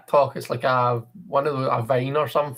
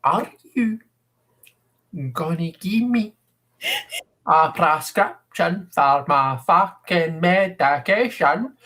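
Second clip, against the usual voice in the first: put-on slow, drawn-out Scottish accent, pushed higher; exaggerated slow Scottish accent; 4 semitones higher, slower than usual. Scottish woman asks for her medication again, slow, exaggerated Scottish accent. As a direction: stretch every word like an exaggerated Scottish patient. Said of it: "just a little bit more slowly?".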